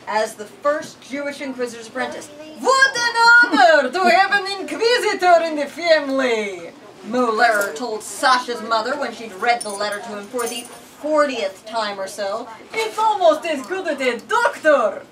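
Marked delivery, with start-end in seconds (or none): 2.6-6.7 put-on high, loud, gushing old man; proud neighbor. A narrator's reading. Neighbor voiced in story. 12.7-15.0 put-on high, loud, gushing old man; proud neighbor. A narrator's reading. Neighbor voiced in story.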